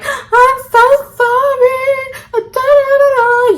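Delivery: very high, very slow wailing sob voice; fake crying. Daughter fake-sobbing in the bathroom to soften dad's anger. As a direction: wail it like a hammed-up apology. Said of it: "now I'm crying in the bathroom".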